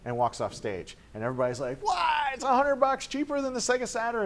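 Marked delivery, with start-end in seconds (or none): 1.8-4.3 high, fast incredulous rush; can't believe the price; stunned disbelief. Retold or imitated. Crowd reacting to Sony's cheaper price, incredulous.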